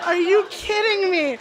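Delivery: high, slow, laughing through the words; half laughing, half crying in disbelief; a fed-up counselor cracking up on the stairs. Real, not acted. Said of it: "I just started to laugh".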